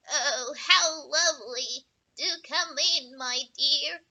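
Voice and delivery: loud, slow croak posing as grandma; croaking wolf. Acted. Wolf as grandma inviting the girl in, slow croak. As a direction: croak sweetly to lure her in. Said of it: "croaked the wolf".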